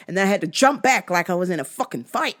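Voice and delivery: normal talking voice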